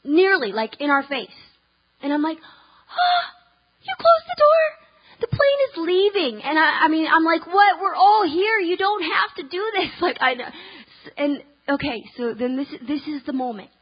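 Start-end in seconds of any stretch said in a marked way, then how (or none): 2.4-6.4 high, loud cry of disbelief; hammed-up shocked protest, high and loud; dismayed outcry. Door closed in her face. 7.5-9.9 incredulous protest. Retold or imitated. Protesting to the gate agent.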